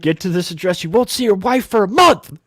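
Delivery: high, loud furious yelling; captain shouting threats at Marcus, furious; angry yelling. Retold or imitated. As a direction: blow up at him.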